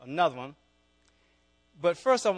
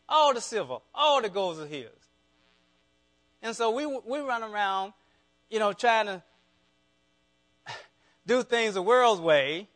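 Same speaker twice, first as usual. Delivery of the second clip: high, loud revival preaching; fired-up preacher in full flow; preaching at full force; 5 semitones higher, 7 dB louder than usual. Acted. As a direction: drive it home to the congregation.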